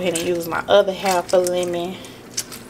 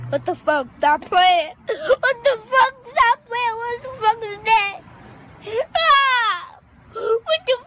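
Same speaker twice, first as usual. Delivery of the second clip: high, frightened cries; real fright, voice shooting up and cracking; panicked shrieking; slower than usual. Real, not acted. Startled by something while cleaning chicken, shrieking in fear.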